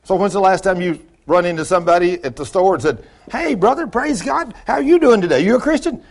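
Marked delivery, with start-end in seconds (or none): 3.3-6.0 high-pitched, over-friendly hail; hammed-up cheery small talk; hearty church greeter. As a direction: act out a chirpy brother's greeting. Acted greeting at the store.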